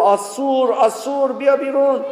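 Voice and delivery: stern rabbi yelling a command; rabbi shouting. Retold or imitated. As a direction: yell at him to get out now. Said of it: "فقط داد زد".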